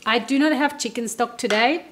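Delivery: ordinary talk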